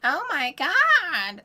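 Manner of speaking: very high, slow, mocking falsetto; mock falsetto Lois Griffin squeal; fake woman voice. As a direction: parody the cartoonish fake woman voice. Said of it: "gonna do a fake woman voice".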